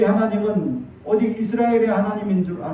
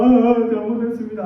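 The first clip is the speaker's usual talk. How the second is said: higher, hammed-up crying apology; mock tearful outburst; 4 semitones higher than usual. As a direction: imitate a boy bursting into tears. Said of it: "눈물로 막 터뜨리면서". (울음 터뜨리는 아이 흉내)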